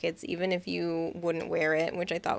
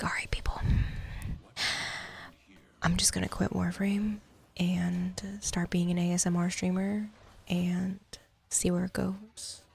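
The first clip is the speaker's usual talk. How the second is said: breathy ASMR whisper; 4 dB quieter than usual. Pretend. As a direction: whisper it close to the mic. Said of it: "the breathy sounds and just be like".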